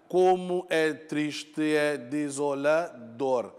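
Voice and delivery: "desolador" said slowly, syllable by syllable, leaning on "dor"; a teacher scanning the line, slowly stressing "dor"; slow syllable scansion. Shown as a demonstration. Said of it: "tônica é no dor".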